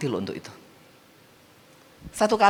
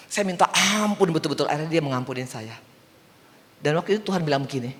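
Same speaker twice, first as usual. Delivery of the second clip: usual speaking voice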